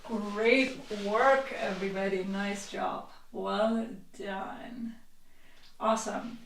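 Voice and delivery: gentle quiet well done; soft praise. Real, not acted. Praising class after exercise.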